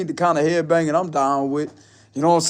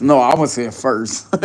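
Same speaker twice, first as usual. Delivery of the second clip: quicker, brighter second character; 6 semitones lower than usual. Pretend. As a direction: answer back in the other voice. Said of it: "two different accents at one time".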